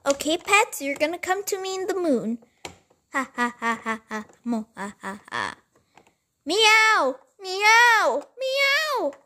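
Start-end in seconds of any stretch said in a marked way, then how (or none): 3.1-5.6 low sinister villain laugh; a low gloating baddie laugh. Villain gloats after theft. 6.4-9.1 loud high kitten meows; high cat meow. Pretend. Cat cries from moon.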